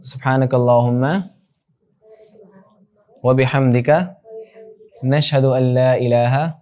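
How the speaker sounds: slow, measured Arabic prayer; teacher closing class with a slow dua; prayer recitation. Acted.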